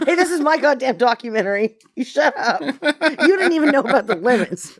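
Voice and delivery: high laughing mock outrage, far from his own voice; mock indignant director. Director laughing as he pretends to be offended. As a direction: protest through laughter, playing the offended director.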